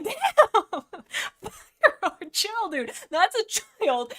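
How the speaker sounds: high, loud, laughing outburst of disbelief; mock shock. Reader scolds Fireheart for insulting a child, mock-shocked. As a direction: exclaim in hammed-up shock, drawing out the last words.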